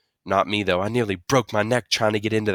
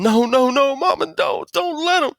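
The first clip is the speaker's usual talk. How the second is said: high, muffled frantic protest; panicked mumbling; 5 dB louder than usual. A narrator's reading. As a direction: protest frantically through stuck lips.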